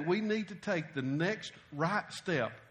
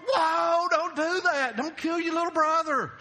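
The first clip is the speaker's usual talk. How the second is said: high, loud panicked yell, retold; imitating a dad crying out in alarm; alarmed dad's shout; 8 semitones higher, 6 dB louder than usual. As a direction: yell to stop the kid in his tracks. Dad shouts at hammer-wielding son.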